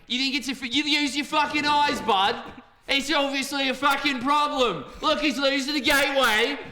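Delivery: a hammed-up Aussie caster losing it at his partner; angry Aussie accent. Arguing with co-caster in a mock Aussie accent. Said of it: "two, uh, shitty Aussie accents".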